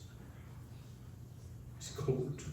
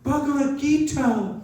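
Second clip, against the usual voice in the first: much higher, louder and slow, overjoyed exclamation; delighted cry; 11 semitones higher, 8 dB louder than usual. Retold or imitated. Old woman spots the holy book, high and thrilled. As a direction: cry out the title as if finding treasure. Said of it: "And she says".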